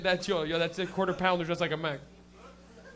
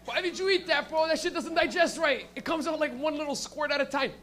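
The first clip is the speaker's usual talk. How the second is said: higher, whining voice griping at himself; mock whiny complaint; 6 semitones higher than usual. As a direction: whine it like a sore-bellied friend.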